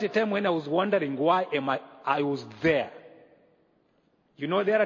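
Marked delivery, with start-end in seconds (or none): none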